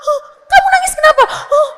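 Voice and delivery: put-on high, loud, frantic questioning of a child; hammed-up wailing interrogation of a sobbing child; mock badgering mother. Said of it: "Jangan juga ditanyain".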